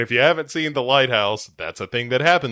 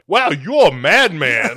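mock impressed passerby; 5 semitones higher, 6 dB louder, slower than usual.

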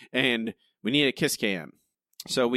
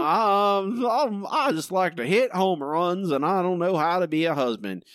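high, hammed-up dimwit athlete; dumb jock; 6 semitones higher, 3 dB louder than usual. Mocking Buck as a hopeless husband, dumb jock voice. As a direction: play a clueless lunk, pitched up.

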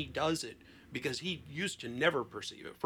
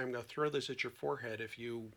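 mock threat; 6 dB louder than usual. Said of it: "and I'd say".

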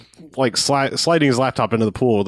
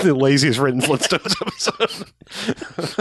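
slow, laughing mock title; giggling through a mock title; 4 semitones higher, slower than usual. Real, not acted. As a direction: read it out while laughing.

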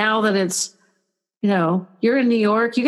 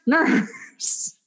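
high, whispered call of "nurse"; a frail resident calling out "nurse" under her breath; 7 semitones higher than usual. Retold or imitated. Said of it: "one of them is always referring to me as nurse".

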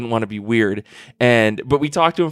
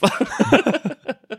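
high laugh; 11 semitones higher than usual. Real, not acted. Laughter after the story.

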